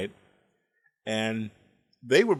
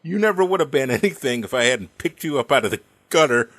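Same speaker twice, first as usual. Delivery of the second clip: imitated mother's loud, angry outburst; mad; 8 dB louder than usual. Mother telling off her husband in a fight, mad. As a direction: let the retort burst out loud, acted fury. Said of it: "my mother got so mad".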